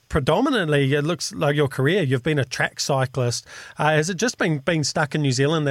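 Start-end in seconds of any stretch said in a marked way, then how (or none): none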